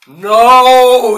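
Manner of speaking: real loud cry of No!, up high; frustrated yell. Real, not acted. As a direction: shout it out in annoyance.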